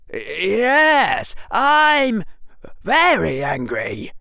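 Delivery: high, loud, stiff fake anger; deliberately wooden mock fury; terribly acted anger; worst acting. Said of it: "worst acting in the history".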